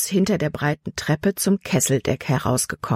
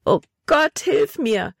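desperate cry; 9 semitones higher, slower than usual. A narrator's reading. Girl alone in the dark calls to God, desperate high cry. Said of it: "rief sie verzweifelt".

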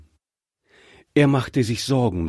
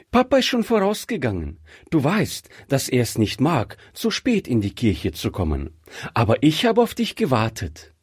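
put-on cheerful young bride; 3 semitones higher than usual. Bride tells her fiancé she waited for him, cheerful.

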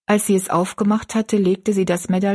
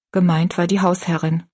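ordinary delivery, nothing marked

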